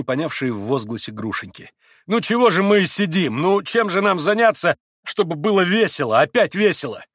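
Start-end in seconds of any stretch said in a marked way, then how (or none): 2.1-7.0 loud, high, restless questions; eager urging. A narrator's reading. Mitya tries to revive the party, loud and eager. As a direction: stir the glum company up.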